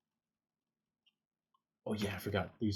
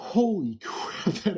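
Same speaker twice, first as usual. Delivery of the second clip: nervous laugh; 11 semitones higher, 8 dB louder, slower than usual. Real, not acted. Laughing after the scare.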